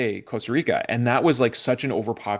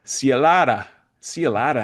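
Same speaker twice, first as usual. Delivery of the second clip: imitating Alex's Spanish accent; a friend's Spanish accent, mimicked in a dream story; 2 semitones higher, 5 dB louder than usual. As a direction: say it the way a Spanish speaker would. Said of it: "in his Spanish accent".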